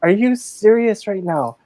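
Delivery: incredulous. Retold or imitated. New supervisor to the hiding manager, incredulous. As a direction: stretch it out in mock disbelief.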